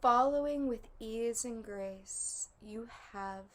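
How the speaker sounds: measured meditation voice with long pauses between phrases; guided meditation. Acted. Leading listeners to relax and follow three guides, guided meditation voice. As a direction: guide them inward, phrase by phrase.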